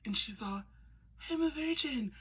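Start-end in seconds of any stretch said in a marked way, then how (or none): 1.3-2.1 put-on high coy girl voice. She's all, quoting Anastasia.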